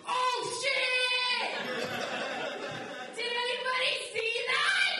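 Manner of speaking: high, loud singsong thrill; excited squeal. Retold or imitated. Comedian reliving the drug deal, high and loud. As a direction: squeal it out in delight. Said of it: "And I was just like".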